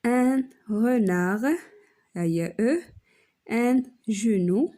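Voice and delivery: stretched out and slow; slow teacher demo. Shown as a demonstration. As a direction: say it slowly so they hear the E. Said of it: "نسطرو على E". (نطق بطيء وممدود)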